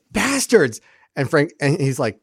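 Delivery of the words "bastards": high, indignant mock curse; mock pissy outburst. Imitating Delenn walking in on Sheridan, comically pissy. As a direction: burst in with a pitched-up, playful swear.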